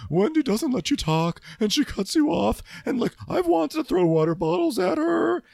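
high, loud squeaky put-on voice; shrill-sounding mock grievance; imitating Robin. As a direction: whine the complaint. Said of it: "Robin was telling Candace".

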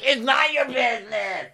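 high, strained mock yell; loud, shrieky put-on rage; raging. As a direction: imitate an outraged friend losing it. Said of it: "already fucking raging".

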